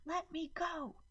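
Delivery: put-on high, strained child's cry; little girl thrashing in an adult's grip; child screaming. A narrator's reading. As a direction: shriek it while struggling.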